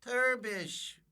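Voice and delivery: high-pitched cartoon character cry; Turbish. Shown as a demonstration. Voice actor shows off a cartoon role, high character call. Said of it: "I did a character called Turbish".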